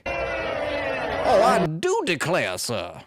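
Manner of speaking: high, drawn-out Southern gentleman; hammed-up genteel Southern indignation; slow, swooping Southern drawl. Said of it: "perfect my Southern drawl".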